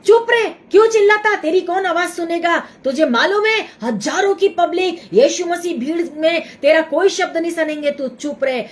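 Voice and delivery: fast, scolding; scolding crowd. Retold or imitated. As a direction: hush him sharply and quickly. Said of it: "भीड़ उसको डांटने लगी".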